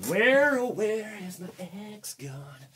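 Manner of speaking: high sing-song searching; mock plaintive. Host hunting for his axe, mock plaintive.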